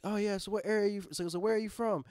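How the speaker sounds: upbeat DJ putting the question; radio host. Retold or imitated. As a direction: imitate a radio host quizzing a caller. Voicing radio host questioning callers.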